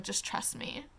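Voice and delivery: a soft whispered reassurance. Retold or imitated. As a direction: whisper it like a secret promise. Danica reassuring Bryce, quoted.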